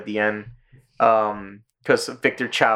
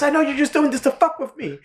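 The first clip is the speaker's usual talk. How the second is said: high-pitched imitated outrage; mock angry accusation. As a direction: accuse him, playing up the indignation. Acts Kyle accusing Cartman.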